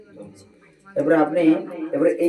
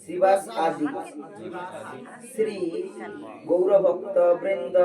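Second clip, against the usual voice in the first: slow recited mantra; 4 dB louder, slower than usual. Shown as a demonstration. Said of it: "পরে পরে বলবেন".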